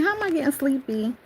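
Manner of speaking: high-pitched puzzled self-questioning; mock bafflement. Puzzled at dozing off over the Bible, high mock bafflement. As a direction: question yourself, voice jumping high. Retold surprise at getting sleepy.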